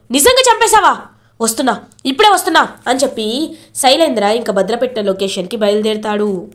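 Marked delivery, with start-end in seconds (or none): 0.1-2.7 thrilled villain who can't wait to see; gleeful disbelief. Acted. Villain hears rivals are killed.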